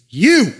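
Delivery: "you" high and loud; stress on YOU. Shown as a demonstration. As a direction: punch the word "you". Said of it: "as in it's emphatic".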